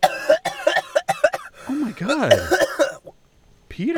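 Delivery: high, loud shocked cry. Acted. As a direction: gasp it out in shock. Shocked at the attack.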